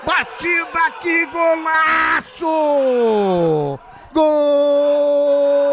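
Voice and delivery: explosive goal commentary. Real, not acted. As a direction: scream the goal out, long and ringing. (narração de gol explosiva)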